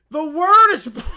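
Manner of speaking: put-on loud, high, giggling kid; gleeful child reveal. Caillou telling listeners his new curse word. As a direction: proudly blurt the naughty word.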